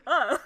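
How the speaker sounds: loud short startled yelp; mock a sudden squeal; imitated shriek. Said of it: "you going".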